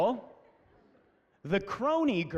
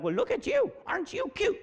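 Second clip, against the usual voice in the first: high, cooing mock old-lady voice; gushing old ladies; 11 semitones higher than usual. Little old ladies admiring the princess, gushing. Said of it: "little old ladies would come up".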